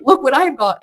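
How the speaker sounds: gleeful showing off, high and loud; happy as hell. Retold or imitated. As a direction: crow over a prize, imitating a giddy character. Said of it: "he's happy as hell".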